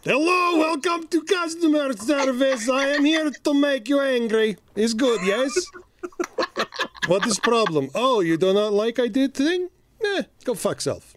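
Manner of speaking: put-on high hammy Russian accent; Russian villain accent. Customer service villain skit.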